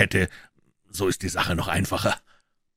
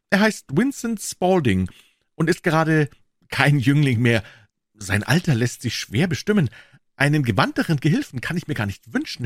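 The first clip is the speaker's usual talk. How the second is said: higher-pitched eager pawnbroker; Wilson's voice; 8 semitones higher than usual. A narrator's reading. Wilson, the pawnbroker, answering Holmes, in a higher voice. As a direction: pitch him up as the earnest tradesman.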